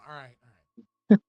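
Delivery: clipped, distorted loud audio; blown out mic. Real, not acted. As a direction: speak through a peaking red mic. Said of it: "Sounds like a blown out mic now".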